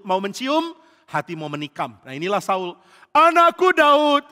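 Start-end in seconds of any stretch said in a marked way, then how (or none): 3.1-4.3 slow, high, loud gushing call; overdone, stretched doting cry; feigned affection. Acted. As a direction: holler false fatherly love. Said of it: "Mulutnya begitu pura-pura".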